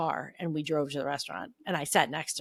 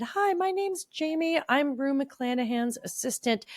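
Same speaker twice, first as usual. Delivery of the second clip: high, slow phone-message voice; voicemail assistant; 7 semitones higher, slower than usual. Retold or imitated. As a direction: imitate an assistant leaving a careful message. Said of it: "a message on my voicemail".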